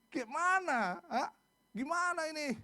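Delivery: high, mock-indignant questions; acted demand for an explanation; complaining. Israelites complaining straight to God, acted protest. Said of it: "mereka komplain langsung sama Tuhan".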